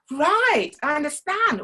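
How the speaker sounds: high, loud stretched vowels; open vowels. Shown as a demonstration. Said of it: "opened up the vowels to say".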